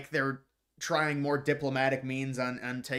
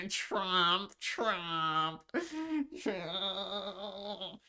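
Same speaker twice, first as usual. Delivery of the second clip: higher, fading mock whimper of the name; mock Kimmel crying; 5 semitones higher, 5 dB quieter, much slower than usual. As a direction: whine it like fake tears, softer each time. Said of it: "It's the same crying".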